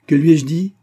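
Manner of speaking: high, loud challenge; defiant retort. A narrator's reading. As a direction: throw the question back at him. Angry heroine confronts him. (réplique haute et défiante)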